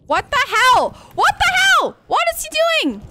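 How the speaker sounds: very high, loud alarmed yell; shocked cry. Real, not acted. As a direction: shriek at the attacker. Attacked by creature.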